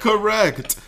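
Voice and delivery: high, playful "correct" with a laugh; game-show confirmation. Pretend. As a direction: ring it out like a mock quiz host. Quiz host confirms answer.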